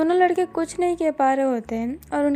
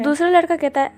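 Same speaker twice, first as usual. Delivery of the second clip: ordinary delivery, nothing marked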